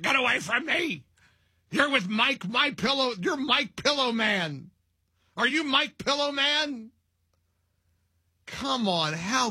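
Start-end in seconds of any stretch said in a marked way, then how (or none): 0.0-6.9 high, hammed-up impression of a cranky old lady; high mock old-lady voice; mocking old woman. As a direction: squeak it out like a grumpy old woman. Host imitating Pelosi again.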